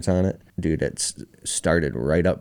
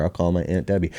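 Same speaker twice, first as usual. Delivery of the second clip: ordinary talk, nothing marked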